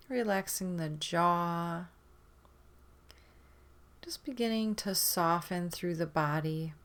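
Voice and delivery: normal talking voice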